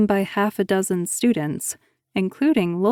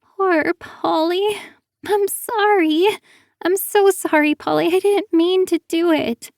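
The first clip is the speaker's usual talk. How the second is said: put-on wailing girl; 11 semitones higher than usual. A narrator's reading.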